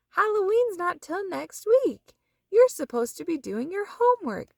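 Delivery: a light motherly reminder; mother's voice. A narrator's reading. Mother reminding Froggy about homework, mild scold.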